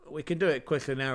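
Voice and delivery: ordinary talk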